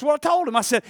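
ordinary talk, nothing marked

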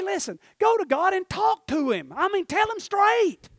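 high, loud, driving exhortation; full-force preaching. Real, not acted. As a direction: holler it out to the back pews.

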